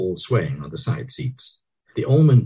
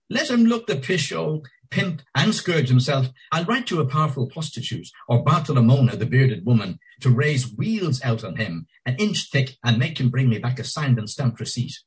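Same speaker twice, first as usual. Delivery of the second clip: high, mocking lady's scorn; scornful woman; 7 semitones higher than usual. A narrator's reading. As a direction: sneer at him up high. Marion scornful of Bloom.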